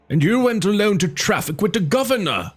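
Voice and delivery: put-on yelling old chief. A narrator's reading. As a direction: shriek the accusation in frenzy. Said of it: "yelled Yevguli".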